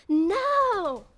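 a drawn-out, high, loud mock outburst; angry mock No. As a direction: mimic the student snapping at the girl. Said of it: "很生气".

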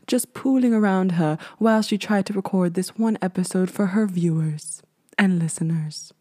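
soft, hushed fairy-tale narrator voice; storybook narration. Pretend. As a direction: tell it like a bedtime story about yourself. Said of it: "narrates herself as a story".

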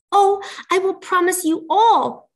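high, loud princess promise; eager princess. A narrator's reading.

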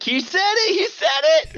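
high, loud gleeful chant; mock alarm cry, way up in pitch; excited calling out. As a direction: yell it high like a tattling announcement. Mimicking the gleeful bell-ringer's call.

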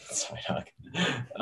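whispered through a laugh. Real, not acted. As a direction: breathe it out while laughing. Repeating her words, laughing.

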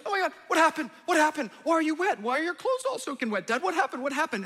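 put-on a dramatic little girl freaking out; dramatic daughter. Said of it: "She's the most dramatic of everyone".

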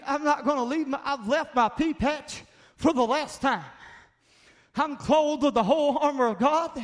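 loud, high preaching cry; a preacher shouting down the devil; fiery preaching. Acted. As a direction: build the congregation up with force. Preaches at full force.

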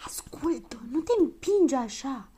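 slow, angry stage whisper; furious hiss. A narrator's reading. Child jostled at the keyhole, hissing furiously. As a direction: hiss it at the one pushing you. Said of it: "Se auzi un șuierat furios".

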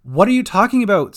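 loud, quick, alarmed question; startled. A narrator's reading. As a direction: fire it back in disbelief. Gran reacts to news of a body.

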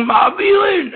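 high, slow shouted cry; retold shout. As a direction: cry the word out. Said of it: "Er schrie".

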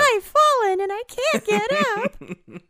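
high, slow TV ad plea; infomercial voice. Acted. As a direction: play the helpless ad victim. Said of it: "You sound like an infomercial right now".

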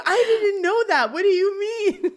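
high, slowed, laughing bewilderment; mock crisis. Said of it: "he had a little moment of crisis".

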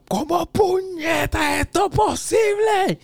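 slow, high yelled outburst; lost it. Retold or imitated. Retelling his yell at the cat who took off her cone, slow high yell. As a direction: yell it out, dragged and disbelieving. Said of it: "I lost it".

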